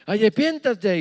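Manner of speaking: put-on high, slow child preacher; a small girl playing pastor, pleading; little sister preaching to her brothers. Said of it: "les decía".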